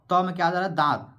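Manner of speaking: normal talking voice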